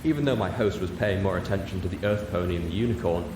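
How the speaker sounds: plain reading voice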